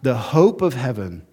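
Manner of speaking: a light attempt at a Scottish accent; hint of a Scottish accent, half in jest. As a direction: borrow the preacher's Scottish lilt for one phrase. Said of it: "I wish I had his Scottish accent".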